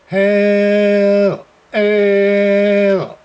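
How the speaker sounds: ordinary delivery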